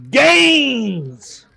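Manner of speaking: screaming gym bro; C O Grizzle hype yell. Acted. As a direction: bellow it like a pumped-up fitness hype man. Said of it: "says C O Grizzle".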